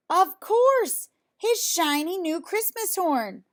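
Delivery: light up as the idea hits; excited storyteller realization; sudden bright idea. A narrator's reading.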